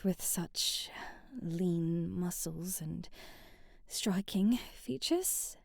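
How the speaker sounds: slow breathless whisper; flustered whisper. Acted. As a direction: let it slip out in a whisper. Flustered admiring the hero.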